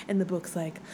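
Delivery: ordinary delivery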